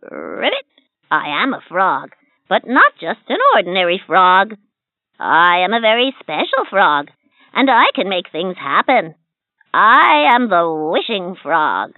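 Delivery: proud little frog, slowing on his name. Acted. Frog character voice.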